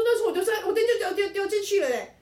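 put-on high, loud voice of a little kid begging; childish pleading. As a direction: whine it like a small boy caught out. Retelling his childhood plea to father.

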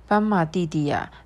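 plain reading voice

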